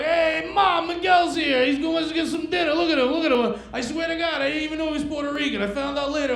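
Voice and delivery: high, eager Italian host voice; a hint of a warm Italian mom's son. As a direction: gush like a welcoming Italian host.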